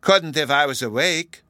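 put-on childishly cunning comeback. A narrator's reading. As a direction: score a point like a crafty child. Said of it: "Bruno said cunningly".

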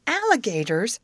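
mild narrated scream of alarm. A narrator's reading. Amy cries out to her friends at alligators, mild scream. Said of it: "screamed Amy".

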